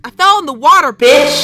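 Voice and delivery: very loud, indignant yell; furious shouted comeback, played up; angry outburst. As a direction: blast it back at a scolding parent. Snapping back at scolding mom character.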